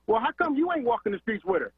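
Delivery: usual speaking voice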